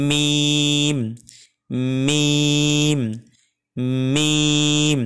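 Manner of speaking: "মিম" with both lips pressed together, long level ee; slightly firm pronunciation. Shown as a demonstration. Teacher modelling correct articulation, "মিম" pressed and drawn out. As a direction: press the lips firmly on each m of "মিম" and hold it out. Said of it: "একটু চাপ দিয়ে মানে একটু শক্ত করে উচ্চারণ হবে".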